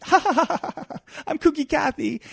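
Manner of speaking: high, giddy cackle of a kooky socialite; kooky laugh. Acted. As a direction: laugh it off like a harmless ditz. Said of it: "and then, like".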